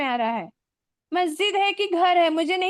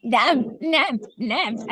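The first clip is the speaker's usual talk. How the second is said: Arabic pronunciation; 4 semitones lower, slower than usual. Retold or imitated. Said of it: "ऐसा वो लोग बोलते हैं".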